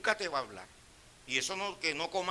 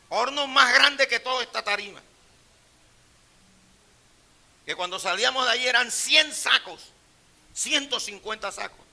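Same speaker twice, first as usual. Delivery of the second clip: loud boast; 7 semitones higher, 9 dB louder than usual. Acted. Boasting to congregation.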